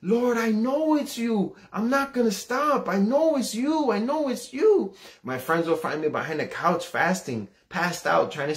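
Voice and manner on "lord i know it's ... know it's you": high, drawn-out tearful pleading, acted; crying plea. Teenager crying to the Lord on leaving home, pleading. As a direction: wail it to heaven like a crying teen. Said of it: "And I'm crying".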